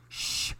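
soft, voiceless shushing; hushing shh. A narrator's reading. Goose silences Duck over the egg, hushing. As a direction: hush them with a quiet shh. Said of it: "Goose hushed".

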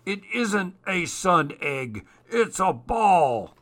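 high excited voice, stretching the last words; gleeful. A narrator's reading. Larch shouts his gleeful discovery.

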